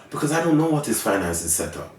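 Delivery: ordinary delivery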